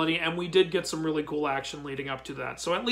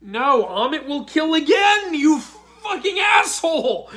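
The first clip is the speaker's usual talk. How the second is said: loud, slow mock-furious yell; half-laughing furious shout; laughing angry shout; 9 semitones higher, 7 dB louder, slower than usual. Real, not acted. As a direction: curse him out through laughter. Furious at Moon Knight, laughing.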